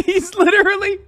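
high voice breaking into loud laughter; real high-pitched laughing fit; cracking up. Real, not acted. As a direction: lose it laughing mid-sentence. Cracks up at Cruz.

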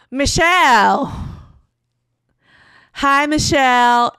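loud drawn-out call over a microphone; loudspeaker call from mom. Retold or imitated. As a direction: call her name slowly and loudly, like a tipsy mom on a mic. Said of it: "And it's my mom".

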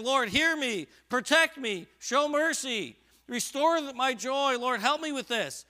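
high, loud desperate plea to God; David pleading. Acted. David crying out to God, a loud high plea. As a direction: cry out to the Lord for mercy. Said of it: "He's saying".

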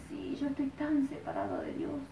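whispered, close to tears; tearful whisper. Retold or imitated. Crowd members whisper through tears to the preacher. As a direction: breathe it out like a crying penitent. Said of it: "Todos lloraban y decían".

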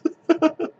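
short high-pitched laugh; high giggle. Real, not acted. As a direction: burst into a giggle. Amused by the zombie event.

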